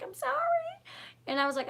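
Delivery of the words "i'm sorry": put-on high, soft little boy's apology; small boy apologizing. Upset son apologizes to his mother, soft and small. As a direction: voice the upset child, small and sorry. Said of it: "he was like".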